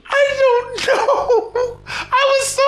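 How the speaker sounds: high, drawn-out, laughing wail; laughing bafflement. Real, not acted. Co-host baffled by a nonsense lyric, laughing high. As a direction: wail it helplessly through real laughter.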